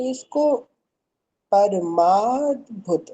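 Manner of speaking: परमाद्भुत slow, both द and भ sounded; careful pronunciation. Shown as a demonstration. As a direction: spell out परमाद्भुत syllable by syllable. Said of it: "दा और भा दोनों का सुर आएगा".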